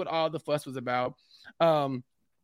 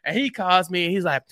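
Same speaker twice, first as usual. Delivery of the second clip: usual speaking voice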